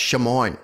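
"Chamoin" said as shuh-MOYN; mistaken pronunciation. Retold or imitated. Host repeating what a friend once taught, "Chamoin" as shuh-MOYN. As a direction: say "Chamoin" with a SH start and MOYN ending. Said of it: "told me it was pronounced".

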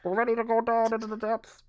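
high, quick put-on voice; mock dive announcement. Host pretending to dive into theories, hammed-up high voice. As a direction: play at being a diver going under.

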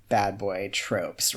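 ordinary talk

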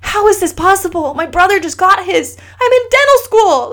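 high, rising pleading voice of her crying husband; a husband's tearful disbelief, voiced high; retold crying plea. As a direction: pitch climbing as if near tears. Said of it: "he called me crying".

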